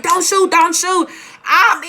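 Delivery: high, loud, stretched-out terrified scream; screaming plea. Retold or imitated. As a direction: scream for your life at the cops, slowly. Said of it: "I was screaming like".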